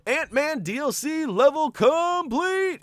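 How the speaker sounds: slow, high, drawn-out announce; excited announcement. Real, not acted. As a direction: announce it like a champion. Announcing completion.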